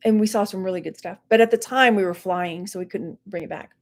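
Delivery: normal talking voice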